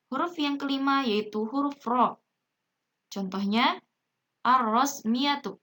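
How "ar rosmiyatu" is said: slow "ar-rosmiyatu" with doubled rolled R; silent lam. Shown as a demonstration. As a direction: read "ar-rosmiyatu" deliberately, no L. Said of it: "Lamnya tidak dibaca jelas".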